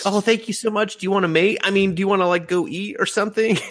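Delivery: high-pitched gushing female impression; eager girl cat. Girl cat asks her rescuer out, high eager voice. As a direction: gush up high, tumbling into a self-correction. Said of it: "she was like".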